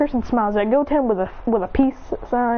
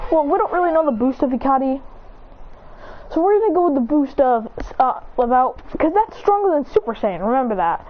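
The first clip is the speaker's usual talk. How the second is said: usual speaking voice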